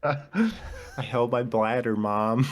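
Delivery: lightly voiced proud kid, drawn out; teasing hint of a child boasting to a parent; mock proud child. Friend teasing another as a kid telling Mom, mock proud child.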